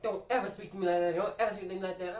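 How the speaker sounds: high mock grandma warning the boy; stern grandma. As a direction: lay down the law like a grandma. Grandma voiced scolding after beating.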